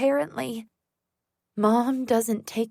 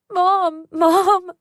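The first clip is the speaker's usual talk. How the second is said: high, loud desperate scream; screaming for Mom; 8 semitones higher, 8 dB louder than usual. Acted. Girl tied down screaming for her mother, loud and high. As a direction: yell for help with everything left. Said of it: "I scream".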